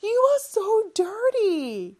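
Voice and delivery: high, slow, appalled; a teacher acting shocked at a dirty kid; shocked disgust. Shown as a demonstration. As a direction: sound shocked at how dirty they are. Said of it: "놀랐어요".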